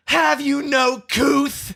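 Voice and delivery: high, slow indignant shriek; outraged old lady. Retold or imitated. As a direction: drag it out, scandalized. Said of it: "she goes".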